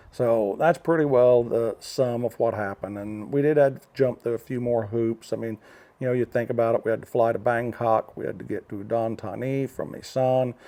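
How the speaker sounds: ordinary delivery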